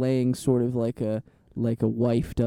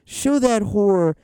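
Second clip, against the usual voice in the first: sassy drag queen; 7 semitones higher, slower than usual. Acted. Continuing the sassy ad bit.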